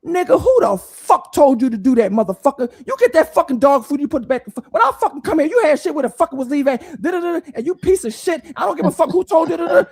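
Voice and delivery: grandma cussing out the clerk loudly; mock furious tirade at the clerk; grandma chewing out the store clerk, loud and angry; angry rant. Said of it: "She said".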